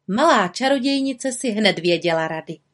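ordinary delivery, nothing marked

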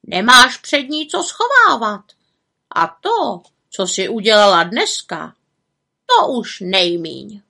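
lightly voiced raven; raven character. A narrator's reading. Havran reassures the little witch about her deeds, light raven voice.

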